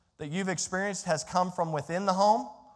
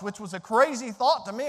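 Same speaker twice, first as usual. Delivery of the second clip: ordinary talk, nothing marked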